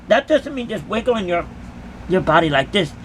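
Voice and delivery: ordinary talk, nothing marked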